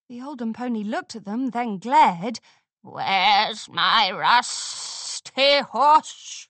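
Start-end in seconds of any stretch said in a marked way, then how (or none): 2.8-6.5 slow raspy menacing growl; menacing rasp. A narrator's reading. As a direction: rasp it out like a threat. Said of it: "she rasped menacingly".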